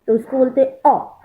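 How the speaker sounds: ordinary talk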